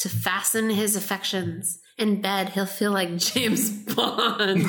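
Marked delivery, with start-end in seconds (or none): 2.5-4.6 breaking into laughter mid-line; cracking up while reading; laughing. Real, not acted. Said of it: "until you find something funny".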